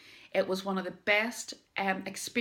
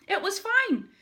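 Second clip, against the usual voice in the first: much higher and louder, squealed with relief; relieved exclamation; 12 semitones higher, 7 dB louder than usual. Retold or imitated. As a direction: burst out that it was fine. Relief after roller coaster.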